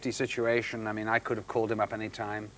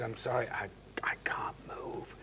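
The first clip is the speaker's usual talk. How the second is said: pained whisper; 2 semitones lower, 11 dB quieter than usual. Retold or imitated. Retelling being unable to move.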